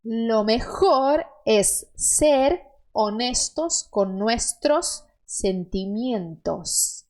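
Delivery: very slow, clear dictation; slow model reading. Shown as a demonstration. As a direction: read slowly for the learner to repeat. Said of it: "última frase".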